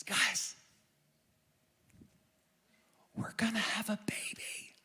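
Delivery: Isaiah whispering the big news to the crowd; whispered secret. Retold or imitated. Dropping the bomb secretly.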